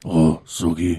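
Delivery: loud mock Batman voice; Bill. Host shows friends how the vampire talks, Batman voice. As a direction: call her name like a hammed-up Batman. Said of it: "he talks like fucking Batman".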